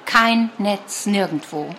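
usual speaking voice

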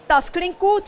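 gleeful storybook character voice; delighted. A narrator's reading. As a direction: perk up with pleasure. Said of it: "freut sich Elvis".

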